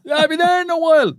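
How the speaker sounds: very high, loud, hammed-up shouting; mock phone excuse. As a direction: shout drawn-out bogus excuses in a put-on high voice. Acts cup-carrier's bogus traffic excuses.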